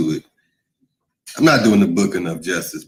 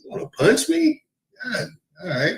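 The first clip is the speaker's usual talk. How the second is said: indignant disbelief; 14 semitones higher than usual. Real, not acted. Reacting to a viewer's punch threat, high-pitched disbelief. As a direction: pitch way up, incredulous.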